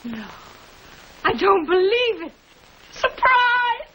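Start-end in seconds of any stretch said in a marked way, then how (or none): 2.9-3.8 high, bright cry of surprise; surprised cry. Acted. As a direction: shout it out as the guests spring it. Surprise guests arrive, crying out.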